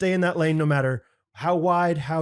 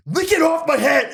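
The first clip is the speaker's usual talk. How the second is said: much higher, louder laughing repeat; shrill mock counselor; 12 semitones higher, 8 dB louder than usual. Retelling the counselor's order to the podcast host, hammed-up squeal. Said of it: "What a weird response".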